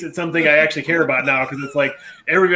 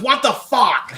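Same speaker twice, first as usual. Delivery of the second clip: put-on Boston accent; 8 semitones higher, much slower than usual. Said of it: "he has a thick Boston accent".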